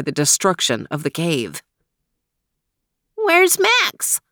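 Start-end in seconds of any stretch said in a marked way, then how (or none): 3.2-4.2 much higher little character voice; high-voiced, searching question; worried question. A narrator's reading. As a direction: ask anxiously in a high, small voice. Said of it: "asked Theo, looking around".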